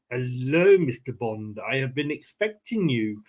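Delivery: a so-so Bond villain greeting his captive. Retold or imitated. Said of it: "not a very good Bond villain accent".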